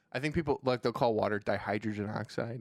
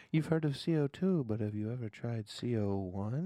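ad voice; 3 dB quieter than usual. Retold or imitated. Mock ad for the gas.

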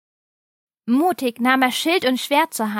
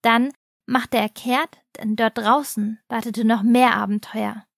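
usual speaking voice

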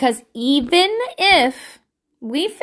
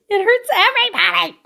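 much higher, hammed-up anguished cry; bigger mock wail; 9 semitones higher, 4 dB louder than usual. Parent lamenting kids crying themselves to sleep, over-the-top mock wail. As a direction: wail it out in exaggerated pain.

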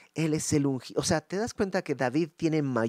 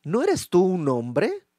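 slow, taunting challenge, higher and louder; provocative; 4 semitones higher, 6 dB louder, slower than usual. Acted. David taunting Abner across the valley, slow and provoking. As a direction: goad him slowly. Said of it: "frase, pues, provocadora".

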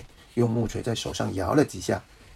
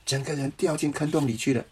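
usual speaking voice

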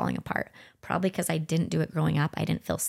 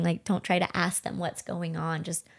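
ordinary talk

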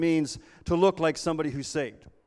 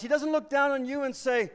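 normal talking voice

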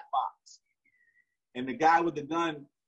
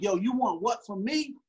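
higher, confrontational "Yo, you want what from me?"; teen squaring up to his father, aggressive; aggressive challenge; 7 semitones higher than usual. Retold or imitated. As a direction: get in his face with it. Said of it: "Now I'm kinda getting aggressive".

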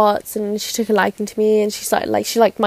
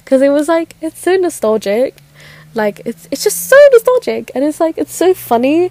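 higher, giddy nostalgic rush; a bit giggly; 6 semitones higher, 3 dB louder than usual. Real, not acted. Speaker reminiscing about her first crush, a bit giggly. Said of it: "I get a little bit giggly".

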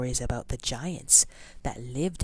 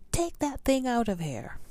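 high, scolding grown-up voice; grumpy grown-up; 9 semitones higher than usual. Acted. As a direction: shoo the kid away, annoyed. Grown-ups scolding the boy.

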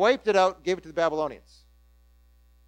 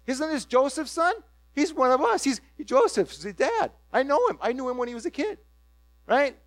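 higher, eager hometown chatter; excited townsfolk; 6 semitones higher than usual. Retold or imitated. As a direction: gush like proud neighbours. Townsfolk voiced.

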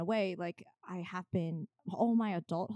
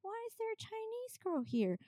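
put-on much higher, childlike pointed question; little kid's voice; 4 dB quieter than usual. Kid pointing at the girl at school, high childish voice. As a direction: innocent kid blurting it out. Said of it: "She's like".

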